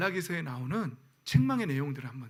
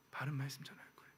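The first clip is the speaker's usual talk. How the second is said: 바른 말씀 전할 거예요 in a fast, unvoiced whisper; whispered vow; 4 semitones lower, 15 dB quieter, faster than usual. Real, not acted. Pastor quietly vowing to congregation, whispered. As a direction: breathe the 바른 as a quiet promise.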